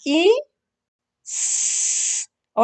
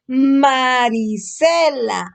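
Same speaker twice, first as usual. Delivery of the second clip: very slow, every syllable of Marisela stretched out; slow reading demo; 4 dB louder, much slower than usual. Shown as a demonstration. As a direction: drag Marisela out slowly for a child to copy. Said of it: "Ahora todo junto".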